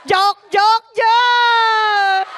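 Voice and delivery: loud, rising cry of joke joke; panicked shout. Retold or imitated. Telling the congregation what she cried to God, loud panicked shout. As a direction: shout it out in alarm at the Lord. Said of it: "Sabi ko".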